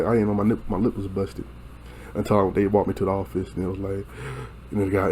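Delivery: usual speaking voice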